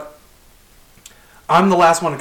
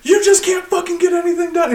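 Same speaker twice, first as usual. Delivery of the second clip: very high-pitched mock rant; retold going off; 13 semitones higher than usual. Boss berating a worker, high mock rant. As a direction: imitate an exasperated boss, pitch way up. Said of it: "he starts going off".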